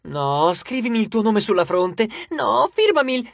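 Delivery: high, excited fangirl pleading; squealing rivalry for an autograph; clamouring fans. A narrator's reading.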